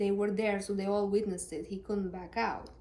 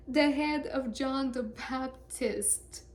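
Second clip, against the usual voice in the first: put-on slow ominous girl; 5 semitones higher, much slower than usual. Girl's dreadful request, acted.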